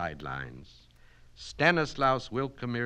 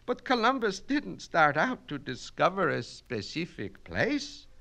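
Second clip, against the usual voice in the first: protesting; 8 semitones higher than usual. Acted. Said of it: "Miss Mitnick protested".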